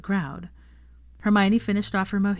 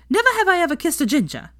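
pointed jab; 12 semitones higher, 6 dB louder, faster than usual. A narrator's reading.

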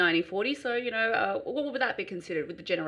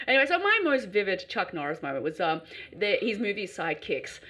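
ordinary delivery

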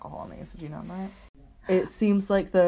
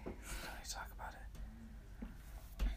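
whisper; 8 semitones lower, 18 dB quieter, slower than usual. Real, not acted. Quiet aside while watching.

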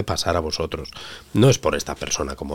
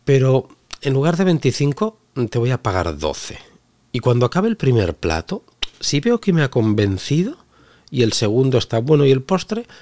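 mock haggling customer; 7 semitones higher, 4 dB louder than usual. Acts cheeky haggling customer.

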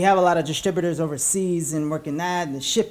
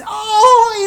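mock pained yelp; 9 dB louder than usual. Headphones bit, yelling.

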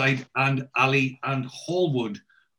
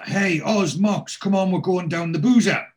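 high, raised-voice shout round a corner; calling out; 7 semitones higher, 4 dB louder than usual. Acted. Shouting round the corner to mates about the pub, calling out. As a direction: call your mates over, loud and high. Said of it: "he shouts round the corner".

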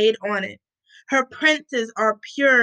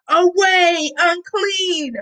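crowd's cry, higher and louder; read-aloud shout; 7 semitones higher, 3 dB louder, slower than usual. A narrator's reading. People driving away the defiled, shouted warning. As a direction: call it out high to drive them off. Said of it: "people shouted at them".

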